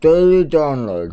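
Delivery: talking around a pen in teeth; words muffled by a pen in mouth. Real, not acted. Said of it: "Why have you got a pen in your mouth?".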